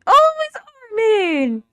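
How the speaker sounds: high, loud, slow cry of delight; excited cry. Real, not acted. Viewer spotting Armin on screen, crying out in delight. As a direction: shout his name with joy.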